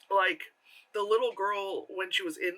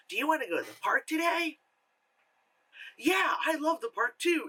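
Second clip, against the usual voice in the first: put-on two kid voices; 9 semitones higher than usual. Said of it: "So it's not just like".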